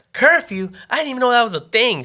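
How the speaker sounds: high, indignant imitation of mom; mom going off. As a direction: go off at the officer as mom. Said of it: "starts going off on the dude".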